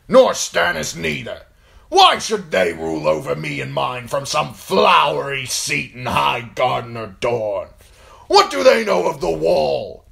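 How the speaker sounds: fiery booming tirade; blustering warlord. Acted. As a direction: bellow your contempt to the whole hall. Great John's shouted speech continues.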